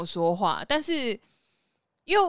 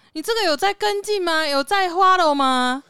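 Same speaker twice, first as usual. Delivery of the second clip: put-on Taiwanese accent; 8 semitones higher, 6 dB louder than usual. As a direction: stretch out follow in a Taiwanese accent. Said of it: "我们老板台湾狗也很重".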